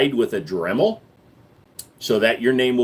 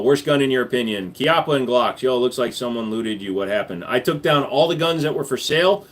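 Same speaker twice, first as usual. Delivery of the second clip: ordinary talk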